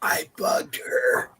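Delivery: unvoiced hushed whisper. Real, not acted. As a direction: breathe it out as a secret. Mock whisper after teasing.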